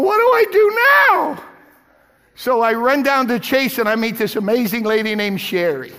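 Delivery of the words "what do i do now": panicked cry. Retold or imitated. Preacher retelling theft, voices his alarm. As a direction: cry out in alarm at the stolen money.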